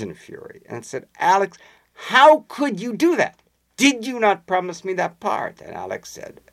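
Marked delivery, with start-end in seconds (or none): 1.2-5.6 a touch of an upset actress, higher; retold upset actress. Anne Todd confronting Alex, higher hurt mock-plea. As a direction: plead hurt and angry, mildly acted.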